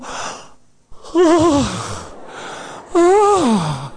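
breathless melting "ah, ah"; a girl swept off her feet by a compliment; swooning sigh. Retold or imitated. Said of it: "ella dijo". (suspiro de enamorada, entrecortado)

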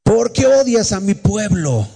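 high, slow divine rebuke; voice of God. Retold or imitated. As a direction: cry it out as a reproach from heaven, drawn out. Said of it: "vino una voz y me dijo".

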